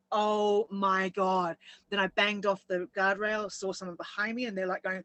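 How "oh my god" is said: very slow re-enacted "oh my God"; drawn-out dread. Driver reliving the spin, slow stunned exclamation. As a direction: stretch each word as the car spins. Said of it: "I remember just going".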